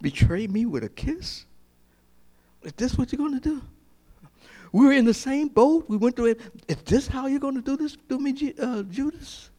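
high, anguished voice of betrayed Jesus; Jesus confronting Judas, high and wounded; pained Jesus. Acted. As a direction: cry out hurt at a friend's kiss. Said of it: "And he says".

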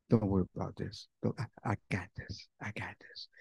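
fading into a whisper; gentle whisper. Acted. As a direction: soothe them down to a whisper. Holy Spirit whispering to heart.

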